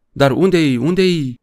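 put-on high, loud, impatient child asking; a kid who can't wait to see; eager boyish outburst. A narrator's reading. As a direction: burst in like an excited boy. Boy bursts in eagerly.

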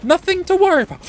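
high, soft-edged reassurance; singsong reassurance. Real, not acted. Player reassuring himself mid-fight, high light reassurance. Reassuring self, then louder repeat.

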